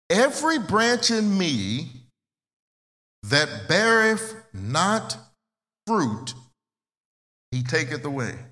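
slow, weighty King James reading, every word spaced out; solemn scripture reading. Acted. Preacher reading John chapter fifteen to the congregation, slow and solemn. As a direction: lay each phrase down slowly, like holy writ.

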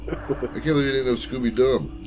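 ordinary talk, nothing marked

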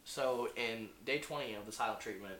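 low, hushed newscaster voice; deadpan parody bulletin; mock news report. Parody bulletin on silent treatment.

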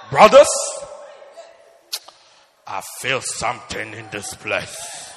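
slow booming prophet voice; biblical voice. Retold or imitated. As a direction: intone it like a prophet. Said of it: "it's a biblical voice".

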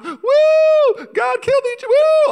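very high, loud mock cheering; jubilant worship whoop. Israelites celebrating the Red Sea split, high hammed-up worship whoops. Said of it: "they write a new worship song".

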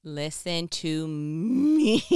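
very slow, loud listen to me; slow emphatic call. Real, not acted. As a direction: hold them with each word. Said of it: "slow emphatic call to attention".

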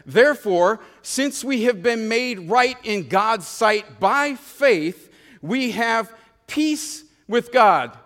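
slow, solemn reading of the verse; scripture reading. Shown as a demonstration. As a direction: read it out slowly and reverently. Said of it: "It says".

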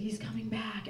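breathless terrified whisper; panicked whisper. Retold or imitated. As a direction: whisper it in panic, barely audible. Panicking as boyfriend returns.

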